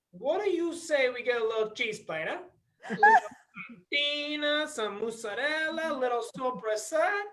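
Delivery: a hint of a New York Italian accent, smooth; Italian-American charm, lightly done; Italian-American waiter. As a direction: sell it like Joey the wiseguy waiter. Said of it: "I'm not gonna be Brooks".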